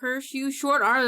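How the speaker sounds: shaking a fist at the sky; mock dramatic curse. Mock cursing her own arms.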